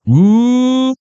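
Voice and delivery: high, loud impressed ooh. Real, not acted. Host reacting high and loud to the fortune.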